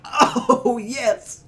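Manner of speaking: high, loud gleeful cheer; excited. Retold or imitated. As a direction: cheer with delight. Retold delight at opening.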